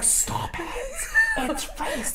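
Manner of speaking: mock urgent whisper; hushed scolding. Imitating her husband stopping her accent, hushed scolding. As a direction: hush someone under your breath.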